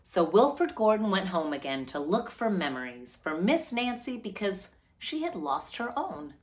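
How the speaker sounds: steady, clear storybook reading; gentle read-aloud to children. A narrator's reading. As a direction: read it like a picture book for a class. Story narration continues.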